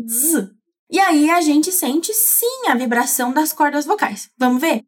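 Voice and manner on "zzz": voiced buzzing "Zzz" like a bee. Shown as a demonstration. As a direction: buzz "Zzz" with voice on. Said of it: "igual ao zumbido de uma abelhinha".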